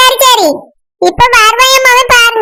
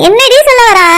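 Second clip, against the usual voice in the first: normal talking voice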